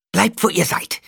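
high, loud order to halt; called-out command. A narrator's reading. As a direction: call out a threat in a high voice. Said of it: "rief Skitale". (hoch gerufener Befehl)

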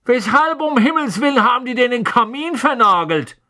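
high, excited fatherly voice; eager wizard dad voice. A narrator's reading. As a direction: play a curious, cheerful father. Mr Weasley voiced as character.

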